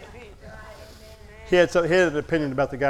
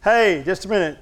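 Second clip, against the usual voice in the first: loud, high, drawn-out calls after someone; re-enacted shout for attention; retold yell; 6 semitones higher, 7 dB louder than usual. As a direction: holler to stop someone walking away. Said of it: "I yelled at him".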